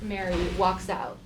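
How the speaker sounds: normal talking voice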